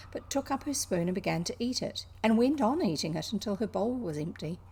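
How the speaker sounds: usual speaking voice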